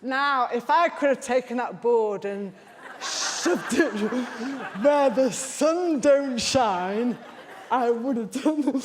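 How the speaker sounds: real laughter breaking into emphatic words; laughing exasperation. Real, not acted. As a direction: laugh through a mock threat. Comic outburst at husband, laughing.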